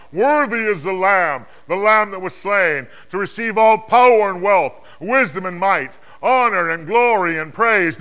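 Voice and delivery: high, loud, slow acclamation; exalted shout of worship; crying aloud. Acted. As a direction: proclaim praise like a vast heavenly host. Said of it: "they cried aloud".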